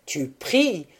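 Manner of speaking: scornful exclamation; incredulous, withering jab; crushing contempt. A narrator's reading. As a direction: throw it at her in disdain. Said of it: "avec un mépris écrasant".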